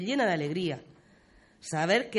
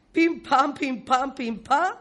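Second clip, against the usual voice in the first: higher, louder rhythmic pim-pam; imitated digging blows; 6 semitones higher, 4 dB louder, slower than usual. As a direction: punch out each blow like a pickaxe striking. (golpes de pico imitados, con ritmo)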